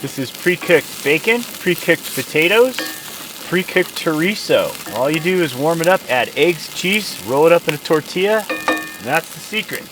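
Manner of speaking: loud product announcer; loud infomercial read; ad pitch. Acted. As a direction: sell the burrito like a commercial. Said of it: "repeats the burrito pitch".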